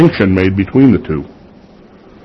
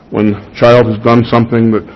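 ordinary delivery

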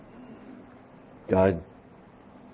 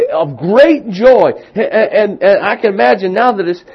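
much higher and louder, building excitement; preaching at full force; 11 semitones higher, 11 dB louder than usual. Acted.

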